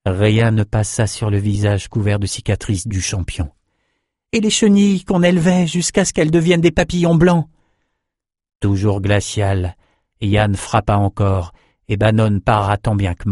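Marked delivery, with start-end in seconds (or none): 4.3-7.5 high, insistent reminiscing; pleading young fighter. A narrator's reading. Swordsman reaches for his friend through memories, insistent pleading. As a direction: press another memory on him, pitch held high.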